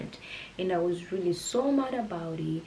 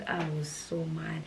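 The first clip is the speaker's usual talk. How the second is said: normal talking voice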